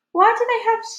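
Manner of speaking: put-on high, loud curious child; boy's high voice. Curious boy asks his grandmother, high and loud.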